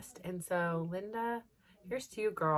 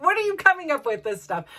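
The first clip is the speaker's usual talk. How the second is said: amused disbelief; 9 semitones higher, 10 dB louder than usual. Retold or imitated. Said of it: "And I'm like".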